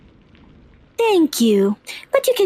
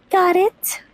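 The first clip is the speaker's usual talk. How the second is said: usual speaking voice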